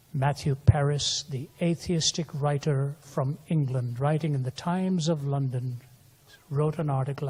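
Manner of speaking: usual speaking voice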